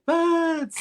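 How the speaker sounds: high, exaggerated mock foreign accent on "Birds"; Iron Sheik accent. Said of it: "'cause that's how Sheik would say it".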